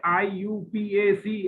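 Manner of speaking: slow "आईयूपीएसी", each letter on its own; letters said separately. Shown as a demonstration. Teacher mimicking students' wrong reading, slowly spelled out. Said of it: "कह रहे हो अलग से".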